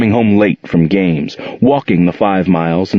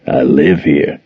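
Jordan; 4 semitones lower, slower than usual. Acted. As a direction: lower man's voice. Jordan answers Gerald at midnight.

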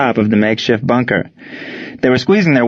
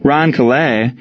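plain reading voice

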